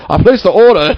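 high, loud haughty order; prince-like demand. Retold or imitated. Retelling the spoiled student at the counter, haughty. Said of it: "came in like a prince and was just like".

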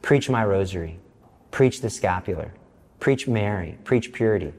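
low, soft commanding words of God; voice of the Lord. Retold or imitated. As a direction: give each command quietly, low. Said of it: "What is your message".

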